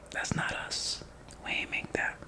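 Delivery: whispered warning. Acted. Boricua friends quietly warning the speaker off bad potato salad, whispered. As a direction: whisper it like a discreet tip-off.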